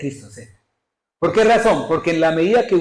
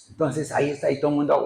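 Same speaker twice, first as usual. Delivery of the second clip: ordinary delivery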